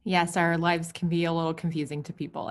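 usual speaking voice

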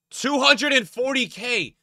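incredulous. Real, not acted. Arguing with chat over prices.

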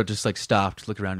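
usual speaking voice

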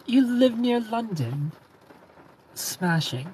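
playful imitation British accent. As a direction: put on a British accent for a greeting. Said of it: "immediately do the accent".

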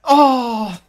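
high, loud pleading yell; will the ball into the net; frantic hope. Real, not acted. Gamer screaming at the ball to go in, loud and high. Shot nearly going in.

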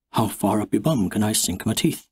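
ordinary delivery

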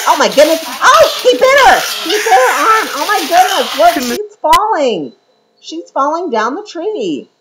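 real shock, high and loud, watching the attack; shocked excitement. Real, not acted. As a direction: gasp out loud as the bear bites. Bear bites the other bear.

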